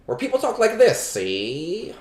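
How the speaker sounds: put-on high, loud old newsreel voice; 1940s newscaster accent; Mid-Atlantic accent. Shown as a demonstration. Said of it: "That, like, Mid-Atlantic accent".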